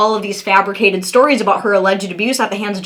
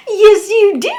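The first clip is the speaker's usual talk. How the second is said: mock sobbing protest; 10 semitones higher than usual. Mocking Amber's denial on the stand, high fake wailing cry. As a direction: ham up a tearful denial, pitch way up.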